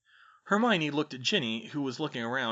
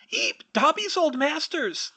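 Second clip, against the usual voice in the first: very high, loud elf squeak; frightened elf; 8 dB louder, slower than usual. A narrator's reading. Elf spotting his old masters, high alarmed squeal. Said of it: "the elf whispered".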